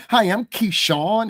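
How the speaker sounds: high, drawn-out mock introduction; put-on fitting-in voice.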